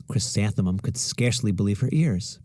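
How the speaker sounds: ordinary delivery, nothing marked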